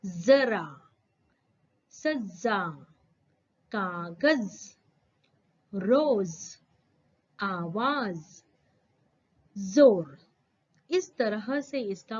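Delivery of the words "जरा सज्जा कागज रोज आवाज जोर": each word spaced out, with a buzzing z in जरा and आवाज; nukta z words. Shown as a demonstration. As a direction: say कागज, रोज as kaagaz, roz with a clear z. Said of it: "इसका pronunciation कैसे होगा देखिए".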